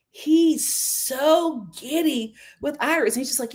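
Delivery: ordinary talk, nothing marked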